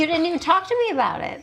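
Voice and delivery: high, fast indignant complaint; the body scolding the mind, high and fast. Pretend. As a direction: complain like an offended partner.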